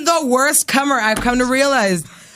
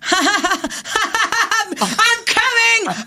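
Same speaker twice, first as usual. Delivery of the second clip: laughing I'm coming; 6 semitones higher, much slower than usual. Retold or imitated. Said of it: "That's literally what that was".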